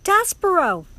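high, loud cry of the name; shouted name. A narrator's reading. Princess calling out to her rescuer, shouted. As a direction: call out across the room. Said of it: "And then she shouted it".

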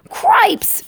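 very high startled cry; Furlow. Acted. Mouse sees his brother being touched, shouting in alarm. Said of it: "shouted Furlow again".

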